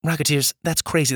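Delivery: ordinary delivery, nothing marked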